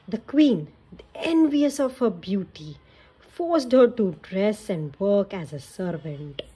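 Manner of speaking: put-on lively read-aloud for kids; storybook narration. A narrator's reading. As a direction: tell it like a bedtime story. Narrating fairy tale to children.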